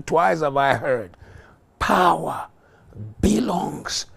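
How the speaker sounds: loud fiery sermon proclamation; forceful pulpit preaching; preaching at full force. Real, not acted. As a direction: thunder the scripture to the congregation.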